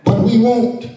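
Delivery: high, sung preaching cadence. Acted. Preacher chants renewal to the congregation, rising sung cadence. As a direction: whoop it to the congregation.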